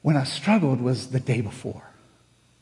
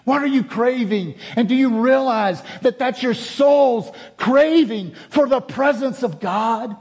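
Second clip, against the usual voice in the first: loud, high, full-force preaching; 10 semitones higher, 5 dB louder than usual. Real, not acted. Preacher asking the congregation what they crave, raised impassioned preaching. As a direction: press the congregation with raised, insistent pitch.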